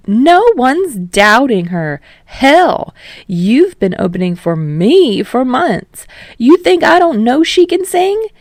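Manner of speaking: loud, high, booming encouragement; brash showman. Acted. Yamada urging Kirishima not to quit singing, loud and brash. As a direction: push him like a big-voiced mentor.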